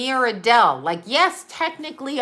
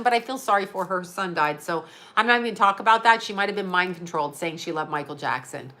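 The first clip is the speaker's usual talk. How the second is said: ordinary talk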